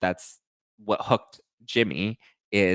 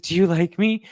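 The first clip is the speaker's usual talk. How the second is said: high, loud eager question; mock needy woman; 9 semitones higher, 6 dB louder than usual. Woman to new fiance seeking approval, mock needy. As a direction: plead for approval, pitched up.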